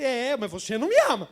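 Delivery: imagined man turning it back on the preacher; mock sarcastic reply. Squeegee man answering the preacher, sarcastic. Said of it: "Eu falo assim".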